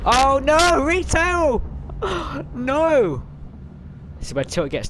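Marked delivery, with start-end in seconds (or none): 0.1-1.6 very high, loud squeal of horror; shrieking dismay. Real, not acted. Watching Retail Row destroyed. 1.7-2.5 shocked high intake of breath; sharp high gasp. Real, not acted. Reacting to destruction.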